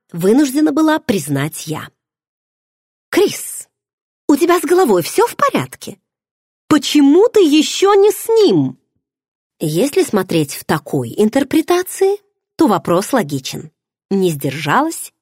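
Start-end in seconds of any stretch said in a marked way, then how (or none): 3.1-8.8 much higher, louder disbelief; a friend aghast, pitched high; incredulous. Acted. (подруга в изумлении, высоко и громко)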